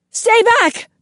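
high piercing scream. A narrator's reading. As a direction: scream it in terror. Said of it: "Kaya screams".

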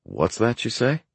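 high, puzzled and whimsical; mock-baffled question; befuddled whimsy. A narrator's reading. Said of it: "befuddled whimsy".

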